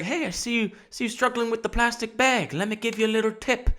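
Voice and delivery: put-on high, soft old-man voice; old dude. Old dude offering a shopper a tip, put-on elderly voice. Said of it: "this old dude came up to me".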